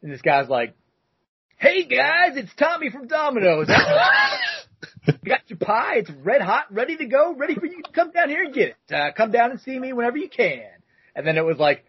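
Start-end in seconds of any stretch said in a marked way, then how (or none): 1.6-10.6 high, bright and eager, way up in pitch; Domino's guy impression. Delivery guy on the answering machine, high and upbeat. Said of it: "I'll do my best here to recreate".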